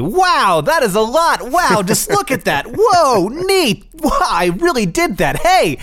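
squeaky, excited silly-character voice; high goofy voice marveling at snot; goofy high cartoon voice. Silly mock sponsor for snot.

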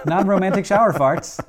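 laughing repeat. Pretend. Repeats sponsor line laughing.

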